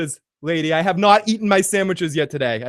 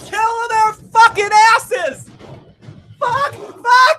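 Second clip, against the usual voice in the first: high, slow, screaming curses; frenzied shout; 4 dB louder, much slower than usual. Real, not acted. Cheering the battle on screen with high screams. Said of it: "apologize for my freaking out in there".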